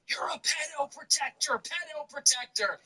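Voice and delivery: put-on high heckling voice; mock heckler yell. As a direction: call it out high like a heckler, at modest volume. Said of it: "yells up to him".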